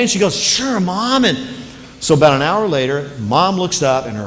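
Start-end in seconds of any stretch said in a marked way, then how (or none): 0.4-1.2 put-on much higher child's voice, breezy agreement; little girl. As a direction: sing it like a carefree kid. Little girl answers mom.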